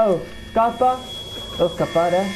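high, panicky outburst; panicked yelp. Real, not acted. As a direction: yelp in sudden fright. Sudden panic in horror game.

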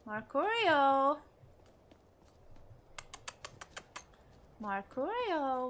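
slow drawn-out call. Real, not acted. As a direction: call the follower's name slowly. Calling for missing follower.

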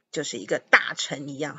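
ordinary delivery

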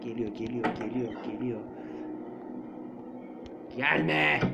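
alçak, titrek korkulu mırıltı; korkmuş ses. Real, not acted. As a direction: yakalanmaktan korkarak kıs sesini. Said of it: "o ses tonum için kusura bakmayın". (izleyicilerden özür dilediği korkulu ses tonu)

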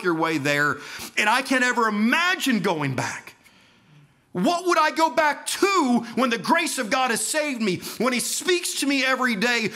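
high, loud, impassioned testimony; preaching at full force. Acted. Preacher testifying to the congregation about leaving Allah, impassioned. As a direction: pour it out, climbing with each question.

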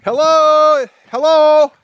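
loud, high call into the empty store; calling down a hallway. Real, not acted. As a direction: shout out to find someone in back.

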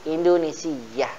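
"Indonesia" said softly as Indunisiyyah; soft demonstration of "Indonesia" with a stressed -siyyah; doubled y, tasydid. Shown as a demonstration. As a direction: hold the doubled y in "Indonesia": in-du-ni-SIY-yah. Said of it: "tambahkan ya tasdid".

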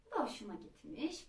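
plain reading voice

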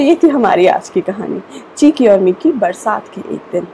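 ordinary delivery, nothing marked

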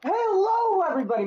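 bright, high-pitched channel greeting; cheesy YouTube intro voice; youtuber intro. Shown as a demonstration. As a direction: greet the viewers like a hyped streamer. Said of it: "How was that? Was that good for an intro?".

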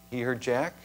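normal talking voice

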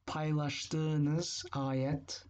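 Paylaştığınız ayet güzel said very slowly and evenly; teacher answering her own question, slow and clear; slow model answer. Shown as a demonstration. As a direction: give the answer slowly, word by word. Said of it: "How could you say".

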